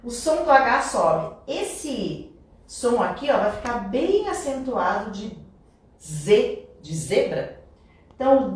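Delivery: ordinary delivery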